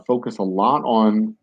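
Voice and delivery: normal talking voice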